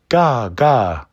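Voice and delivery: put-on cawing baby raven. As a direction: caw like a crying raven chick. Baby raven crying caw.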